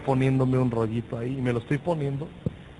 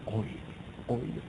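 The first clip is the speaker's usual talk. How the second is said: much softer, intimate plea; hushed prayer; 3 semitones lower, 5 dB quieter than usual. Retold or imitated. Preacher retelling his prayer, very soft. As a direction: pray it almost under your breath.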